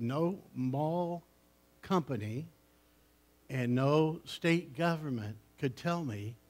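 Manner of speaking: normal talking voice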